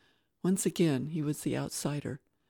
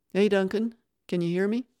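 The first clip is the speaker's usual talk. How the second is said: raised radio call to the boss; calling over the radio; 4 semitones higher, 7 dB louder than usual. Acted. Foreman calling the boss over the radio, raised voice.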